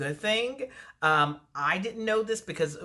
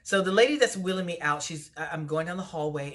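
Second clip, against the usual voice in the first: ordinary talk, nothing marked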